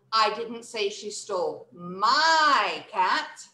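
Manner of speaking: slow line with a long "my"; stress on MY. Shown as a demonstration. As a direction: hold "my" to claim the cat. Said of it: "Now we're emphasizing my".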